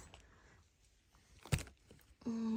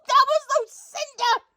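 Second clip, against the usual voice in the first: high mock shout; hammed-up shout, pitched way up; 11 semitones higher than usual. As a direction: keep yelling it up high. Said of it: "you don't need to shout".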